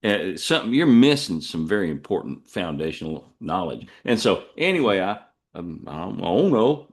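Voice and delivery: ordinary delivery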